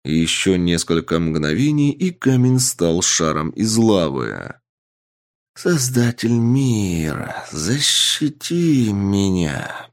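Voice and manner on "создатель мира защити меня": slow, otherworldly inhuman voice; eldritch horror. Acted. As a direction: speak like an ancient being from the depths. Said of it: "раздался голос ... не человеческий голос". (нечеловеческий потусторонний голос)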